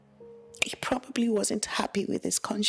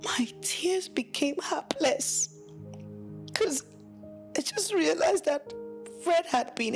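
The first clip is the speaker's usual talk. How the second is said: tearful narration; 9 semitones higher than usual. Acted. Wife telling her story, crying as she speaks. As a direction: let the tears come as you tell it.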